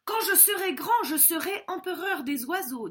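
high, excited little bird; eager young César. Acted. César tells his father his dream, high and eager.

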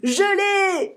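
high, loud joyful whoop; triumphant cry. A narrator's reading. Billy catches the bison, triumphant high cry. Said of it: "s'exclame Billy".

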